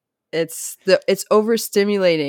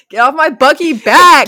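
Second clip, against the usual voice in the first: higher and much louder playful shout; mock yell; 8 semitones higher, 11 dB louder than usual. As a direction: bark it at the pushy stranger in jest. Acting out telling off the man.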